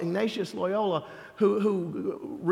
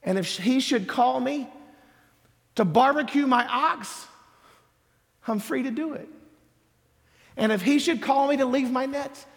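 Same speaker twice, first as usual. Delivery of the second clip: full-force preaching; 5 semitones higher than usual. Acted. Preacher rousing the congregation, full-force preaching. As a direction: build it up, line by line.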